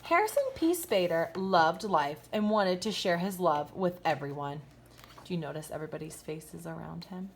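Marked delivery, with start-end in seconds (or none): 0.0-4.6 lively, bright storybook read-aloud with big swoops; reading to children. A narrator's reading. Teacher reading a picture book to her kindergartners, animated storybook voice. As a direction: read it like story time for little kids.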